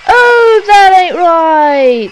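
loud, high, drawn-out dismay; appalled outcry. Real, not acted. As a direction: wail it slowly in horror. Appalled at the horror footage.

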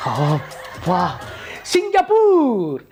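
husband rejoicing in secret over his escape; gleeful. Acted. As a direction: gloat happily once she's gone. Husband celebrates alone after fooling wife.

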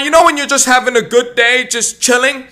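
ordinary talk, nothing marked

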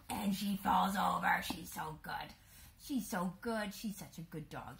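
breathy whisper to the camera; whispered aside. Real, not acted. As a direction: whisper so the dog keeps focus. Aside while dog works.

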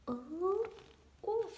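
usual speaking voice

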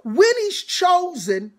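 ordinary delivery

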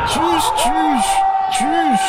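high, loud taunting bye-bye; shouted goodbye. Real, not acted. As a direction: yell the goodbyes high and gleeful.

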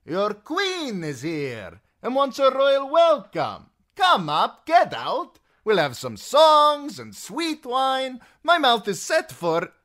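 high, loud, drawn-out hail; boisterous ringing call. A narrator's reading. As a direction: announce it grandly to a hidden crew. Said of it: "he called as he leapt down".

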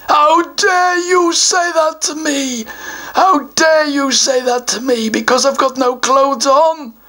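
a troll taking the answer personally; offended troll. Acted. Troll takes offence at the child's answer, mock offended.

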